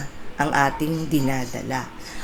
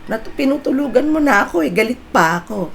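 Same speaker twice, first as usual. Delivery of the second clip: whiny complaint; 8 semitones higher than usual. Retold or imitated. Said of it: "Sabi ko".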